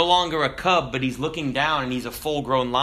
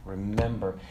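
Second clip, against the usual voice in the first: fading out; 5 semitones lower, 7 dB quieter than usual. Acted. Ghost father fading from the sky, low receding voice. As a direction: let the ghostly voice sink away. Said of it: "as he fades out, he says".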